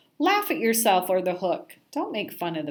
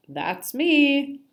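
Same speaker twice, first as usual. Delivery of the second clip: loud high fish voice; 7 semitones higher, 6 dB louder than usual. Acted. Fish cheerfully agreeing.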